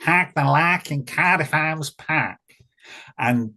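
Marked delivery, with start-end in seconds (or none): 0.0-2.4 broad Cardiff accent, higher and louder. Shown as a demonstration. Showing a listener how Cardiff folk sound, broad Cardiff accent. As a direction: drop into a strong Cardiff accent. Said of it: "it's hack like that".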